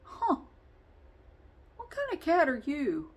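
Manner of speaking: curious cat voice. Pretend. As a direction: play the cat staring at a zoo exhibit. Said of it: "looks at her like an exhibit".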